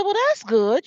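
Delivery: high-pitched retold approval; lightly gushing, voice lifted; approving. Praising an old man for singing in choir, approving.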